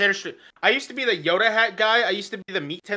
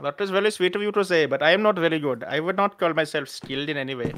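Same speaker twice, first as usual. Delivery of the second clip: put-on Indian accent, modest and polite; courteous mock Indian accent. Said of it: "when you're doing Indian accent".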